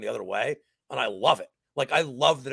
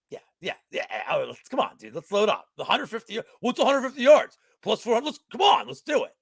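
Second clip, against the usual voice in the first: egging-on bar buddy, pitch climbing; Pat; 7 semitones higher than usual. Retold or imitated. Friend at the bar urging a bet, imitated eager pestering. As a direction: imitate a pushy friend, building from casual to high and insistent. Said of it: "my asshole friend Pat".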